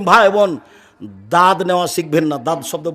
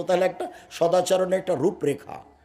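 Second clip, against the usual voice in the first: ordinary talk, nothing marked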